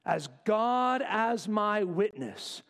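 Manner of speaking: emphatic, drawn-out oath-taking; swearing an oath. Acted. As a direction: stretch each word as a sworn vow. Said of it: "swears an oath".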